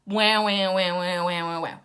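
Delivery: imitated whiny crying sounds; mock wailing. Mocking Scarlett upset over Ashley's engagement, mock wailing. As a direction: run the wahs together as a fake bawl.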